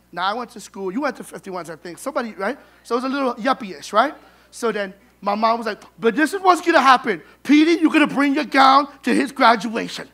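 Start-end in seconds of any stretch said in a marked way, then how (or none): 6.0-10.1 bossy mom. Retold or imitated. Mom insisting, voiced loudly.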